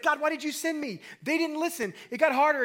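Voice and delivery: high, whiny protest to God; upset complaining. Retold or imitated. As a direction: complain like a frustrated Moses. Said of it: "he's so upset".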